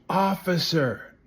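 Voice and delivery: high, slow, drawn-out mock call; calling for police. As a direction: ham up a Karen summoning the cop. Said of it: "she goes".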